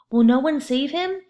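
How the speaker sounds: wife calls up to the townspeople; calling out. A narrator's reading. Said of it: "she called to the people".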